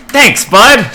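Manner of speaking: high bright burst of thanks; excited thanks. Acted. Lee thanks the creature cheerfully.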